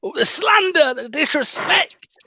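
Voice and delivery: high, hammed-up gasp of offence; theatrical fake indignation; mock outrage. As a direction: play it like a wounded diva, slow on disrespect. Mock outrage at fan's dismissal.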